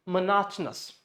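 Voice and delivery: monotonous as muh-NAHT-nus; monotonous squeezed to muh-NOT-nus; stressed NOT in monotonous. Shown as a demonstration. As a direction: reduce monotonous around a strong "not". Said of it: "So this is monotonous".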